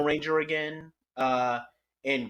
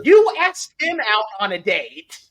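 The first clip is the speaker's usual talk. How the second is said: exasperated; 7 semitones higher, 10 dB louder than usual. Retold or imitated. Exasperated retort to character.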